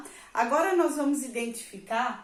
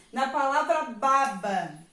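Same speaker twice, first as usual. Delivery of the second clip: ordinary delivery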